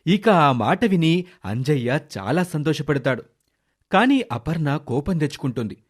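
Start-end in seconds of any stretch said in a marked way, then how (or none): none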